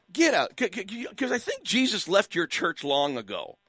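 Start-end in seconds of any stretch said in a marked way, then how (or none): 0.1-0.5 "Get out." said higher and louder, punched; emphatic louder "Get out."; louder repeat. Real, not acted. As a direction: hammer the "Get out." home.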